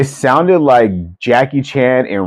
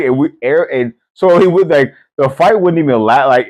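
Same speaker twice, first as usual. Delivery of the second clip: normal talking voice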